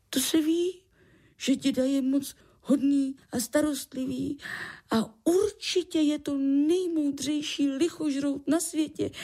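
put-on high-pitched child voice; young child's thoughts. A narrator's reading.

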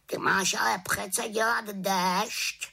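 high-pitched scolding raven; reproachful raven croak. A narrator's reading. Raven scolding the little witch over the rain, reproachful croak. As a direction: croak it as a nagging bird. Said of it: "krákal vyčítavě".

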